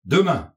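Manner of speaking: DEMAIN loud, high, then falling; emphatic answer. A narrator's reading. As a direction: confirm "demain" with a firm downward fall. Irnoy confirms in answer.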